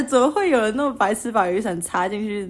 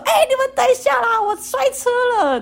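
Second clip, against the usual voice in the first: imitated yell. Friend who fell off bike calls to others, high mock yell. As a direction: play a panicked friend calling after the group. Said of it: "他就突然这样大叫说".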